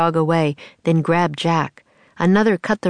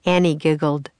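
plain reading voice

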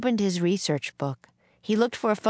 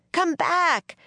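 much higher calling out; crying out; 12 semitones higher than usual. A narrator's reading. Jack calls the departing wagon back, high urgent cry. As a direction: call after someone, pitch way up. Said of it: "Jack cried".

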